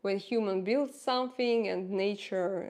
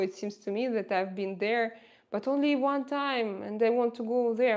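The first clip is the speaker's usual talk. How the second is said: usual speaking voice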